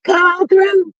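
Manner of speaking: high signature catchphrase call. Shown as a demonstration. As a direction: repeat the call, just as bold. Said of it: "in my G voice".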